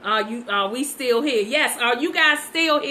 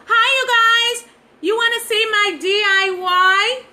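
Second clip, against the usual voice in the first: high, loud put-on voice mimicking them; mocking imitation; 10 semitones higher, 7 dB louder than usual. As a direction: mimic them in a squeaky, over-eager voice. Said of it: "That's how they sound".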